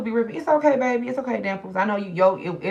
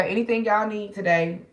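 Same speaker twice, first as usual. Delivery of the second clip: ordinary talk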